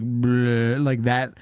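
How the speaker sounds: ordinary talk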